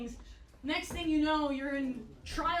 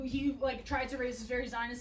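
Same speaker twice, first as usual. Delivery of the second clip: ordinary talk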